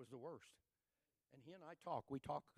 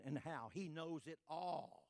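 normal talking voice

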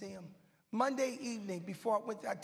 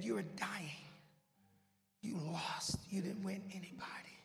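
softer, whispered menace; taunting spirit whisper; 4 semitones lower, 7 dB quieter than usual. Retold or imitated. Evil spirit taunting the dying preacher, menacing whisper. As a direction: whisper doom like a demon.

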